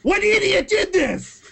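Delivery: theatrically appalled, pitch jumping way up; exasperated outburst. Pretend. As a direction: cry it out in hammed-up disbelief. Outburst about the moods feature.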